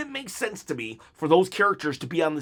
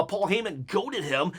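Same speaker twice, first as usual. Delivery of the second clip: ordinary talk, nothing marked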